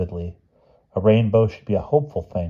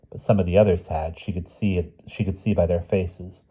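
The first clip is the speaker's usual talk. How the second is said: plain reading voice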